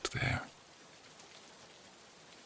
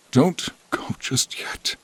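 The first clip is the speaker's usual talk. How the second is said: high, hushed begging; a whispered please stay; 9 semitones higher than usual. Acted.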